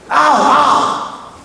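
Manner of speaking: high-pitched mock yelp of pain; acted cry of pain. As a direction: cry out as if suddenly hurt. Climber cries out in pain.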